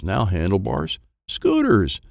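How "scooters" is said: excited. A narrator's reading. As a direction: shout it in delight. Kids discover scooters.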